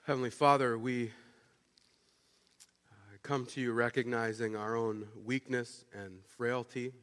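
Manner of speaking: soft, low, unhurried prayer voice. Acted. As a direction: lead the congregation gently in prayer. Leads a prayer.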